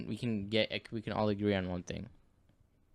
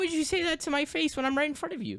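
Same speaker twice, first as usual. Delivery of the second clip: high-pitched mock indignation; hammed-up offended squeal; mock outrage. Mock fight starts.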